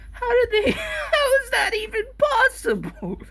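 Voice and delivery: high-pitched incredulous laugh-talk; shocked laughing disbelief. Retold or imitated. Uncle laughing in disbelief at the mix-up.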